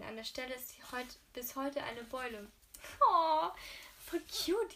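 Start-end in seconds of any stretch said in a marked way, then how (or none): 3.1-4.7 much higher, louder squealing oh, voll cute; excited squeal. Real, not acted. Reader squealing high and loud over the love story. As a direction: squeal with delight at the sweetness.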